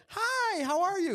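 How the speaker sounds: very high, chirpy mock greeting; overfriendly approach. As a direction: greet them with exaggerated friendliness. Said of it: "we came up and we were like".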